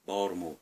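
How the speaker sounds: "Baltimore" mumbled low the local way, like "Bawlmer". Shown as a demonstration. Local answering where he's from, mumbled. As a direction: drop and clip "Baltimore". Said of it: "when you hear them say".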